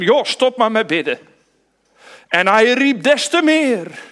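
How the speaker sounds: high and loud, urgent; loud retelling. Acted. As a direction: call it out over the crowd. Voicing the crowd and Bartimaeus. (luid en hoog)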